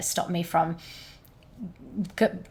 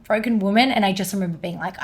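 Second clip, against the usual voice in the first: ordinary delivery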